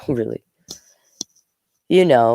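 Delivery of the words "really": laughing aside. Real, not acted. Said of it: "reader laughs at the text".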